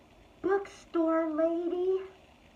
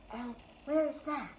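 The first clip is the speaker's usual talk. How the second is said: normal talking voice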